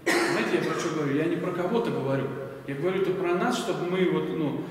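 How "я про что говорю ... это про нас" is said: ordinary delivery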